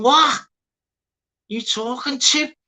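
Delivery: high-pitched, indignant challenge; mock confrontation. Streamer mimicking someone kicking off in the panels, high mock challenge. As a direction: put on a high, squawky comeback. Acting out someone starting trouble.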